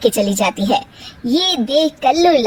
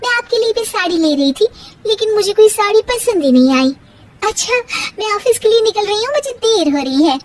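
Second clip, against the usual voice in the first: put-on higher, brisk young woman's voice; daughter-in-law Tara brushing off her mother-in-law; 7 semitones higher, faster than usual. Tara voice again.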